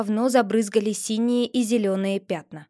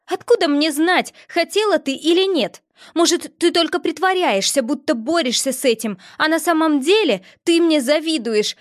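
higher, louder angry princess voice; angry accusation; 5 semitones higher, 4 dB louder than usual. A narrator's reading. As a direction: jab at her like a jealous rival.

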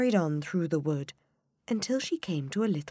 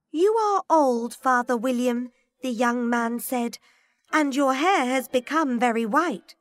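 poem recitation; 8 semitones higher, 6 dB louder than usual. A narrator's reading.

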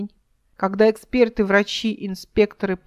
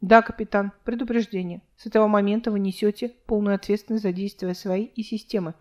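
ordinary delivery, nothing marked

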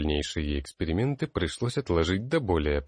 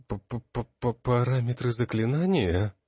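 higher, stuttering on the first sound; stammering confused echo; 5 semitones higher, slower than usual. A narrator's reading. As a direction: stammer it out, lost for an answer. Confused student echoes the question. (растерянно, заикаясь, выше)

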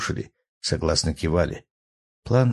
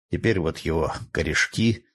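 ordinary delivery

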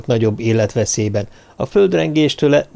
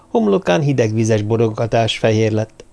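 ordinary delivery, nothing marked

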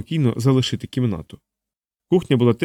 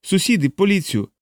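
high, pleading scared man; scared homeowner pleading innocence; frightened man; 7 semitones higher than usual. A narrator's reading. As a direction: babble in panic, pitched up.

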